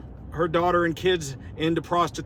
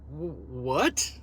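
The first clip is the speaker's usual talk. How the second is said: stammered, baffled comeback; mock bafflement; 3 semitones lower, 5 dB quieter than usual. Vlogger replays being called a skinhead, baffled. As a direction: act taken aback by the accusation.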